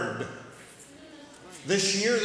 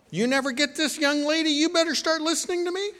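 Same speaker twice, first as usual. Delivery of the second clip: higher, fast, mock-stern parent; scolding parent; 9 semitones higher, 5 dB louder, faster than usual. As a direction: playfully tell her off, quickly.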